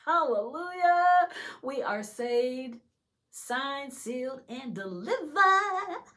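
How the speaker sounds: high, loud, drawn-out proclamation; exultant preacher building with joy; excited preaching. Real, not acted. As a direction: proclaim it jubilantly, stretching the words. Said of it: "I'm so excited".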